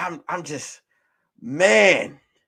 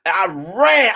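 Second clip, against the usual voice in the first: ordinary delivery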